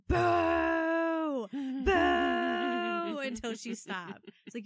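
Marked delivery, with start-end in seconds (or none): 0.1-3.2 loud mock booing, higher. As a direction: boo her down, loud and high. Booing the coworker's examples.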